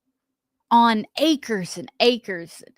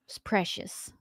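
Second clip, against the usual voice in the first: hushed; 3 semitones lower, 12 dB quieter than usual. Real, not acted. Holly moved by the sad video.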